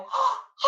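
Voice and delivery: a sharp, high gasp of joy; excited gasp. Retold or imitated. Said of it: "He was so excited".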